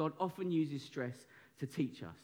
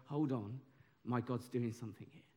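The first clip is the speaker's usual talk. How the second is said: "Hold on, my God's doing something here" said softer and lower, hushed; quiet trusting aside; 6 semitones lower, 7 dB quieter than usual. Shown as a demonstration. Preacher showing the congregation a quiet, trusting response. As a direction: murmur it like a private realisation. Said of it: "look at stress and go".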